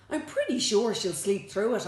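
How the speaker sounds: confidently brushing the doubt aside; great confidence. A narrator's reading. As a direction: say it with breezy certainty. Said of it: "I said with great confidence".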